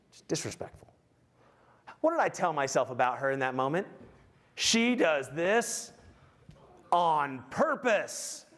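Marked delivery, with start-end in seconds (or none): 0.1-0.9 breathy whispered indignation; whispered sulky complaint; whispered grumble. Retold or imitated. Voicing his offended thoughts. 4.6-8.5 drawn-out accusing words; very slow sarcastic accusation; slow resentful drawl. Retold or imitated. Voicing his resentful thoughts.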